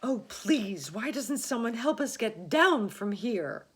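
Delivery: high, loud plea for help; calling out. A narrator's reading. Miss Spider calls out to the men below, high and loud. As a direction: call down from the peach. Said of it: "Miss Spider called out".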